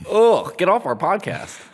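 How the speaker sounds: high, loud, drawn-out mock scolding; hammed-up exasperated dismissal; mock disgust. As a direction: banish the listener in pretend outrage. Mock scolding of an imagined listener.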